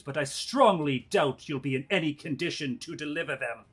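loud villain monologue, rising to a shout; slow theatrical villain menace. Acted. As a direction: gloat like a scheming noble. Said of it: "give me a villain monologue".

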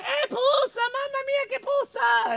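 very high squeal of disgust; mole holding nose. Acted. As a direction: squeak at the awful stench. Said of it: "La piccola talpa si tappò".

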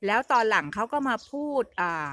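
ordinary delivery

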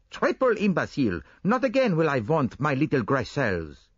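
mock yourself with zeal; self-scolding Poirot.

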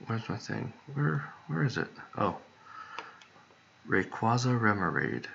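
ordinary talk, nothing marked

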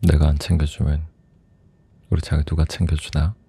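ordinary delivery

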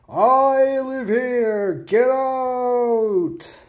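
slow, high ghostly wail; unearthly voice. Acted. Ghost voice threatens visitors.

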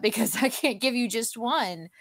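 usual speaking voice

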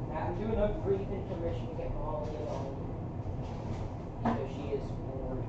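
hushed, soft and fast; whisper. Real, not acted. Streamer whispering about taking Molly aside, hushed whisper.

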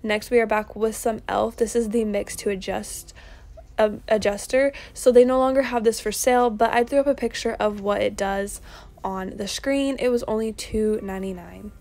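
normal talking voice

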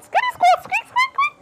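very high squeaky chatter; tiny high mother-mouse squeaks; Mama Mouse squeaking. Acted. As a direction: give orders in mouse language. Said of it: "And then she said".

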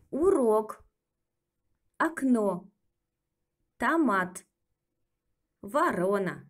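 Урок with full O, окно and ворона with O said as A; stressed O full, unstressed O like A; Russian O reduction demo; O reduced when unstressed. Shown as a demonstration. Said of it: "always had the sound O with the accent".

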